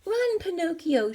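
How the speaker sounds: a high urgent shout. A narrator's reading. Old man urges the puppet to escape, shouting high. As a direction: yell at him to flee. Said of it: "shouted Geppetto".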